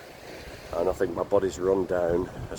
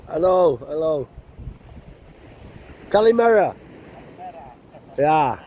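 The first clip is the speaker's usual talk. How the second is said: higher, louder hail to a passer-by; called-out greeting; 8 semitones higher, 4 dB louder than usual. Real, not acted. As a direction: call out a cheery hello across the way.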